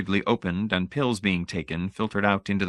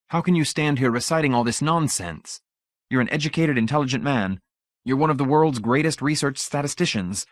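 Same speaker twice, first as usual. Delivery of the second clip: high, exasperated protest; acted indignation, pitched well up; indignant outburst; 6 semitones higher than usual. A narrator's reading. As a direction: confront him in a raised, urgent pitch. Acted angry outburst in story.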